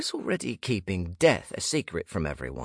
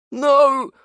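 much higher, loud anguished shout; anguished cry; 12 dB louder than usual. Acted. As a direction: cry out in horror. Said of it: "a man cried".

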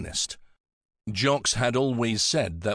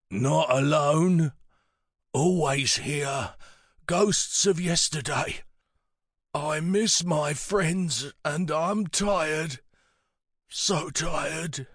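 put-on high, very slow, halting old man; weary old man; 6 semitones higher, much slower than usual. A narrator's reading. Pritch weary of life, missing friends, slow frail old voice. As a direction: drag out each phrase as a tired elder.

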